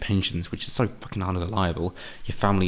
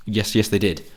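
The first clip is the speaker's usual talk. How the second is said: usual speaking voice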